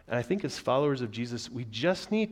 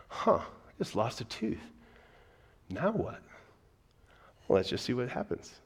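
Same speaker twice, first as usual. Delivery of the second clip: inner Bob Ross. Retold or imitated. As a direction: shrug it off gently, curious. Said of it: "I find my inner Bob Ross".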